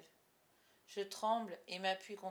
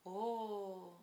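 drawn-out "oh" of an audience; long, admiring oh; exclamation. A narrator's reading. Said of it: "Tout le monde s'exclame".

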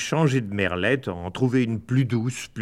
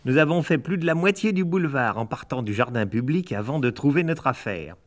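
ordinary delivery, nothing marked

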